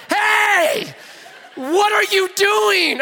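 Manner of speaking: high, loud call, slowing on what are you doing; mock shout of an alarmed flight attendant. Flight attendant catching him at the door, high mock shout.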